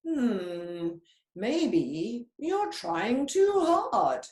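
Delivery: slow, thoughtful big-cat voice; wise jaguar. Acted. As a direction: muse it out slowly, like gentle advice. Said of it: "he said".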